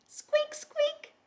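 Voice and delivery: tiny, high mouse squeaking; mouse squeaks. Pretend. Teacher shows children the mouse cue, tiny high squeaks. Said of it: "when you hear mouse".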